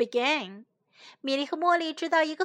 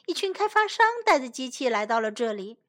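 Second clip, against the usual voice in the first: ordinary delivery, nothing marked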